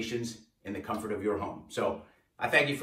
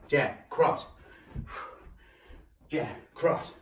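audible breaths with each punch; breathing hard; much slower than usual. Real, not acted. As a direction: exhale sharply on every punch. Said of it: "Hear I'm breathing every time?".

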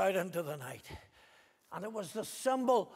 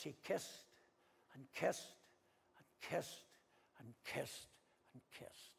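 kissed repeated, softer each time until breathless; fading to a whisper; 4 semitones lower, 11 dB quieter than usual. Acted. Preacher telling of Judas's kiss, fading to a whisper. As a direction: let each and kissed die away to a whisper.

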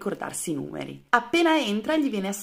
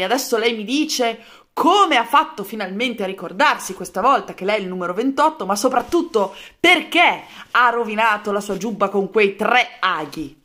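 loud exclaiming; 3 semitones higher, 8 dB louder than usual. Acted. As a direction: boom it out at the recruit. Colonel relieved yet loud.

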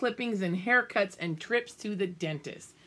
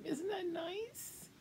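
soft aside; 11 semitones higher, 11 dB quieter, slower than usual. Real, not acted. Reader's soft ending aside.

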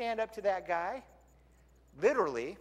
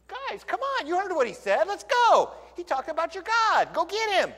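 high, eager urging; rally them with boyish excitement; eager David; 11 semitones higher, 6 dB louder than usual. Retold or imitated. Young David urging his brothers to fight, high and eager. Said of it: "David was like".

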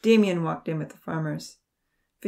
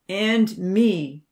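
loud, defiant interjection; piping up; 2 semitones higher, 7 dB louder than usual. A narrator's reading. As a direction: chime in boldly. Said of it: "Damien piped up".

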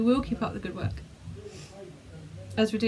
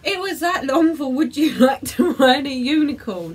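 incredulous; 3 semitones higher, 7 dB louder than usual. Real, not acted. Amazed at long phrase's short meaning.

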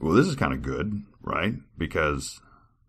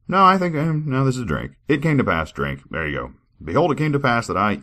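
higher, louder formal reading voice; scripture reading; 4 semitones higher, 4 dB louder than usual. Acted. Reader performing a scripture passage for viewers, formal. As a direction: read it like solemn old scripture.

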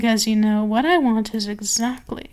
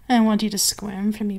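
sultry mistress. Acted. Mistress to her purring pet, sultry and teasing.

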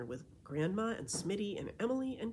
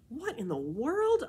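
higher and louder, slow, in disbelief; astonished cry; 8 semitones higher, 7 dB louder than usual. Acted. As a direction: blurt it out stunned. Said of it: "I cried".

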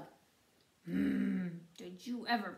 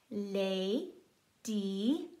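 very slow, each beat split out; syllable by syllable; 4 semitones lower, much slower than usual. Shown as a demonstration. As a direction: stretch it into separate syllables. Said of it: "Let's try it".

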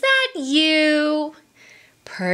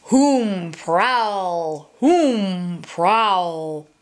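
low hooting creature call; low prowling animal sound; 5 semitones lower, much slower than usual. Acted. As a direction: hoot it low and slow. Animal call in picture book.

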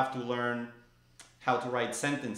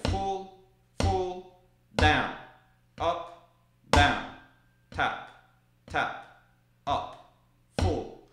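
loud sharp DOWN, lighter other words; calling out strokes; 3 semitones higher, 6 dB louder, much slower than usual. Shown as a demonstration. Teacher calls strokes aloud, punching DOWN. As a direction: call each stroke aloud as you play it. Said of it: "you say out loud the type of stroke".

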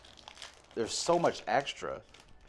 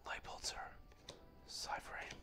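much softer, whispered card names; 18 dB quieter than usual. Real, not acted. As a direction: breathe the names out. Reading cards under breath.

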